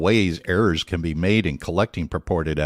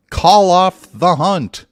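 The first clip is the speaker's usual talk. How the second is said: louder, slow and emphatic; 10 semitones higher, 8 dB louder, slower than usual. Retold or imitated. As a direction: announce it like a headline. Reading magazine headline aloud.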